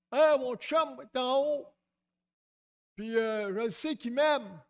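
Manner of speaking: lightly voiced know-it-all; smug believer. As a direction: imitate someone smugly sure God is his buddy. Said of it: "qui appelait Dieu son chum".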